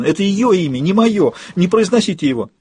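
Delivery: high, distressed sobbing protest. A narrator's reading. Woman rejects another's name as hers, sobbing. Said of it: "всхлипывала женщина".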